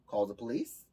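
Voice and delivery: normal talking voice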